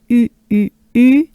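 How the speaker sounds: loud rounded French "U"; loud pouted U sound. Shown as a demonstration. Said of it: "the French R and the U".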